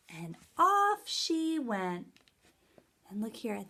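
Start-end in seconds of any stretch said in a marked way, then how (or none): 0.1-2.2 slow, drawn-out, a little higher; slow storybook ending. A narrator's reading. Teacher closing the story for kids, slow and drawn-out. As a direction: let it linger as she floats away.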